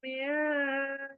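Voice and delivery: मिन with the n faded into the nose; hidden nasal noon. Shown as a demonstration. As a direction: let मिन ring softly through the nose. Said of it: "नून नाक में चली जाएगी".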